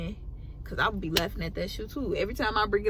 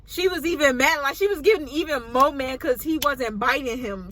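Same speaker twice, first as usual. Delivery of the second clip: ordinary talk, nothing marked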